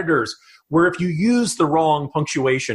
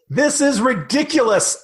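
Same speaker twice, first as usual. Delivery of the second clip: mock exclamation; 7 semitones higher than usual. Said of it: "exclamation point".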